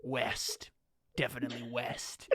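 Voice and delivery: a hint of a solemn tracker, hushed; tracker's verdict. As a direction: say it softly, like reading the trail. Voicing Ernest sniffing for tracks.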